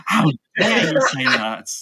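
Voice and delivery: mock outrage. Mock outrage at canapes joke.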